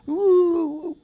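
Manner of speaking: high hammed-up ooh of feeling; mock emotional ooh. Teasing herself about getting emotional, a high mock ooh. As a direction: pretend to be overcome. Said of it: "See, you're all emotional".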